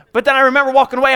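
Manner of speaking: ordinary talk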